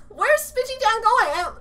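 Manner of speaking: ordinary delivery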